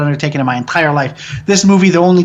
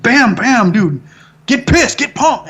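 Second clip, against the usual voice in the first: mild mock yell, pitched up; retold yell; 5 semitones higher, slower than usual. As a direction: re-enact goading an actor, half-yelled. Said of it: "I just yelled at him".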